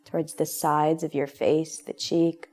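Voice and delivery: calm, unhurried meditation voice with long pauses; guided meditation. Real, not acted. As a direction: guide them gently into relaxation, leaving space between phrases. Leads a guided relaxation meditation.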